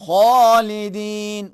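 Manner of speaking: خالدین with a thick, full خ, slow; heavy kha. Shown as a demonstration. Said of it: "اسی انداز میں موٹا تلفظ کریں گے".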